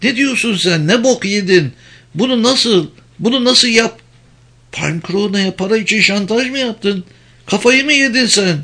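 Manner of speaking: high-pitched, indignant outburst; outraged disbelief. Acted. Acted outburst of furious Penelope.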